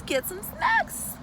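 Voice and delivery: squeaky high and slow; high silly voice. Pretend. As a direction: coax them in a tiny high voice. Playful high-voiced invitation to viewers.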